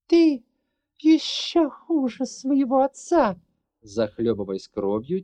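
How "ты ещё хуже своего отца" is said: forced out. Acted. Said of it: "выдавила из себя".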